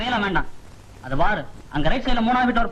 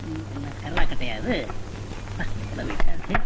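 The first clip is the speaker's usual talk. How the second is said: straining under weight; 9 semitones lower, 4 dB quieter than usual. Acted. Lifting heavy luggage.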